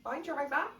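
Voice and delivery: high sing-song Scottish bye; Scottish accent. Retold or imitated. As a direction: mimic a Scottish woman's goodbye. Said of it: "Scottish one where the woman's going".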